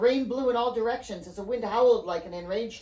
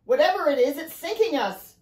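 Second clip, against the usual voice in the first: higher, raised, urgent voice; urgent cry; 5 semitones higher, 5 dB louder than usual. A narrator's reading. Father warns the children, urgent cry. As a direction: cry out over the chaos. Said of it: "Riley's father cried".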